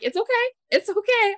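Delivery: gently calm the apologizing barista; reassuring. Retold or imitated.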